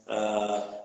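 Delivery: ordinary talk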